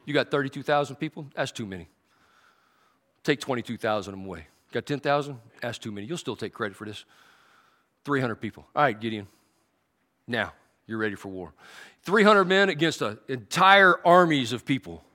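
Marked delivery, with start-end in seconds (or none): 0.0-11.5 low, soft, unhurried divine voice; calm God voice. Acted. God instructing Gideon, lowered and calm. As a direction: speak as a patient, steady God. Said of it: "God says".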